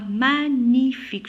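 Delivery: slow "magnifique", no g heard; careful drawn-out "magnifique" without hard g; silent g. Shown as a demonstration. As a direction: stretch "magnifique" in syllables, ma-ni-fique. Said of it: "je la prononce pas".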